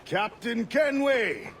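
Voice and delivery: high, drawn-out hail; hailing call. Acted. Shipmate hailing his captain, calling out long and high. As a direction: call out the name, stretching it.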